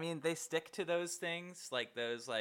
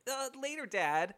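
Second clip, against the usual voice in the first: put-on dismissive teen; 12 semitones higher than usual. Daughters brushing off dad.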